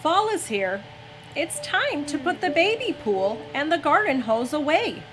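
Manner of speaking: ordinary delivery, nothing marked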